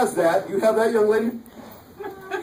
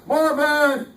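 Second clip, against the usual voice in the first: mock call for dog; 7 semitones higher than usual. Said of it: "he's yelling for his dog".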